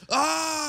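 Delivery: high mock cry of pain; drawn-out movie-hero holler; action-hero yell. Co-host playing a blockbuster hero shot in the arm, mock yell. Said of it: "it's like the action hero".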